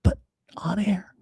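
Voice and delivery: breathy conspiratorial whisper; giggly prank whisper. Real, not acted. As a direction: whisper it like sharing a secret. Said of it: "I'm whispering into the mic".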